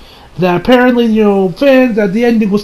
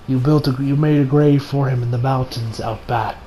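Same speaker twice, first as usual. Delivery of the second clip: tender reminiscence; 5 semitones lower, 4 dB quieter than usual. Acted. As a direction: recall it gently, with halting pauses. Acting a dying man's fond memories.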